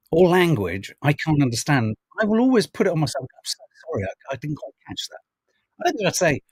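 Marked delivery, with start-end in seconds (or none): 3.3-5.2 soft, self-effacing mock apology; polite apology. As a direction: act out a quiet, apologetic request to repeat. Acting out a polite, self-blaming apology.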